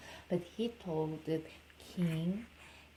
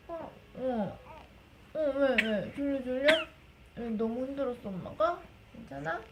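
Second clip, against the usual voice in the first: plain reading voice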